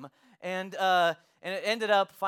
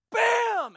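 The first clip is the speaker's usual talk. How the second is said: high-pitched punched "bam"; imitated bang; 3 dB louder than usual. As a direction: voice the impact of a kick on a door. Imitates kick hitting the door.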